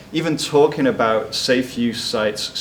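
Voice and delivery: ordinary talk, nothing marked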